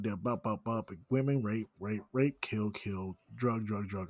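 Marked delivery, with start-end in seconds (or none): none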